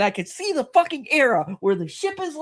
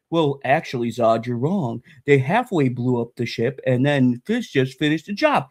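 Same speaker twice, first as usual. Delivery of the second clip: much lower, dopey mock voice; mocking know-it-all; 13 semitones lower than usual. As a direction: lampoon the smug corrector. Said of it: "don't come at me going".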